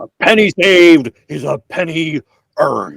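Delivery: a fair stab at Nixon. Pretend. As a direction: read it like Richard Nixon. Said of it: "gonna have to go with Nixon".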